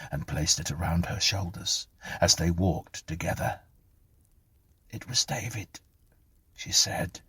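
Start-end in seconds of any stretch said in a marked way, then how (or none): 4.9-5.8 softer, higher, timid voice; meek explanation. A narrator's reading. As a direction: explain yourself in a small voice. Clara explains herself meekly.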